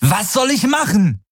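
high, strained exasperated cry; angry, desperate shout. A narrator's reading. Pilot snaps back at Kirai's demand, desperate high cry. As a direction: throw it back in open desperation. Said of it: "rief er, ohne seine eigene Wut".

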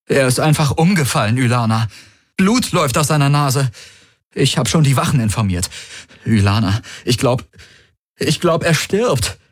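high, urgent, halting alarm; feigned panic. A narrator's reading. Man reports his collapsed friend to Ylana, in feigned panic. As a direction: play the frightened friend, stumbling toward the worst.

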